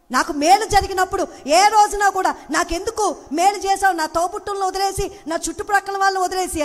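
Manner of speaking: loud, high pulpit cry; rising sermon exhortation; fervent preaching. Acted. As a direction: press the point to the congregation.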